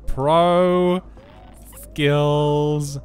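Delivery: loud, high, stretched-out words; drawn-out boast. Real, not acted. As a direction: crow it long and loud. Boasting after ducking to safety.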